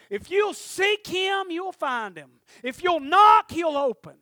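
loud, rising preacher's cry, slowed on the promises; preaching at full force. Acted. Preacher crying out God's promises to the congregation, loud and slow. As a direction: build it up and shout the promises to the congregation.

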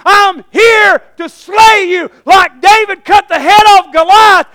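high, loud battle-cry shout; full-force preaching. Acted. Benaiah facing the lion to slay it, shouted challenge. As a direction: roar the challenge to the enemy.

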